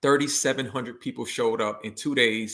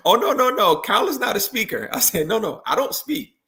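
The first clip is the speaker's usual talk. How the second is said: much higher, hammed-up protest; mock-panicked no no no, pitched way up; flustered refusal; 9 semitones higher, 4 dB louder than usual. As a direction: act out flustered panic at being asked to speak. Refusing to speak at rally.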